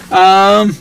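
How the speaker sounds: halting, stuck on filler sounds; stuttering over um and uh. Real, not acted. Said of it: "I'm stuttering".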